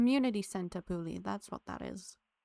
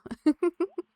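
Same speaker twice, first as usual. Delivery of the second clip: loud laugh; 9 semitones higher, 11 dB louder than usual. Real, not acted. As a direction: burst of higher, louder laughter. Laugh after teasing Hannah.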